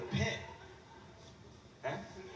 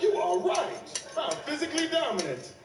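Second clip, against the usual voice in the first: slow, loud, higher boastful voice; hammed-up cocky shoulder devil; devil angel; 6 semitones higher, 3 dB louder, slower than usual. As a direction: puff up and boast like a cartoon devil.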